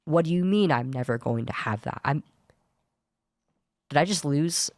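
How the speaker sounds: ordinary delivery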